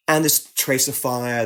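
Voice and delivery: normal talking voice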